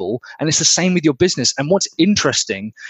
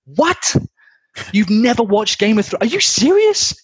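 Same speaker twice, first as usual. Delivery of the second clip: amazed disbelief; 10 semitones higher than usual. Retold or imitated. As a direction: act stunned that anyone missed it. Friend amazed at no Game of Thrones.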